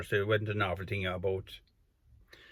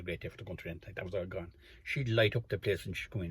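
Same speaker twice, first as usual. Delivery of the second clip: ordinary talk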